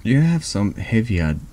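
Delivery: ordinary talk, nothing marked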